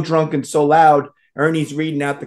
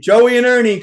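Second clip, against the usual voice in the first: loud, higher beckoning call; 8 semitones higher, 6 dB louder than usual. Acted. Glowing blackjack table summoning two gamblers, playful call-out. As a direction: voice a table luring players over.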